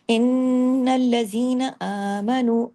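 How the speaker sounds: آمنوا with آ and نو each stretched; slow recitation. Shown as a demonstration. Teacher recites for students, stretching the long vowels. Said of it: "آ کے اوپر دیکھیے".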